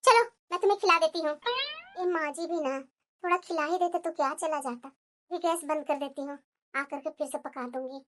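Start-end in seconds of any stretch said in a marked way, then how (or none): 2.0-8.0 low, soft private grumble; grumbling to herself. Acted. Daughter-in-law alone frets about mother-in-law, grumbling to herself. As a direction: think aloud, much softer and lower.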